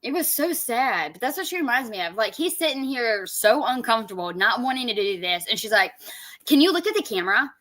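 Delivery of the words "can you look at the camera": quick, higher-pitched mimicry; mock nagging request. Reactor mimics woman prompting uncomfortable man, mock nagging. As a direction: imitate a bossy camera director, fast and high.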